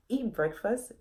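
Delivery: plain reading voice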